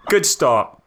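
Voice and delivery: ordinary delivery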